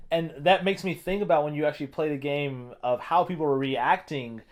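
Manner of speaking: ordinary talk, nothing marked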